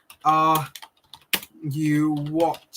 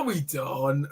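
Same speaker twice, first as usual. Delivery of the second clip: normal talking voice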